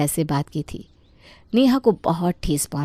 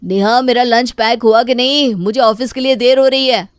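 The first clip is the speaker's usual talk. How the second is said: loud, higher hurried call to his wife; 6 semitones higher, 8 dB louder than usual. A narrator's reading. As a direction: call out, late for the office. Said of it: "Arun Neha को आवाज़ लगाता है". (पत्नी को ज़ोर से पुकारना)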